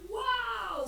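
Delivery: high, bright gasp of wonder; amazed wow. Acted. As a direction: sound truly amazed. Reader exclaims at story.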